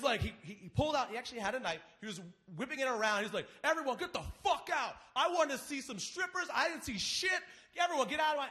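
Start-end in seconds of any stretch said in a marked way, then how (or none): 3.6-8.5 higher, pushed yell, hammed-up; mock angry yell. Angry host ordering everyone out of his house, yelling. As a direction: bark it like a furious host. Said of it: "He was pissed off".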